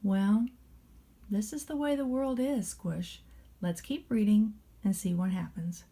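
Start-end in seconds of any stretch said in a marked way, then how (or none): none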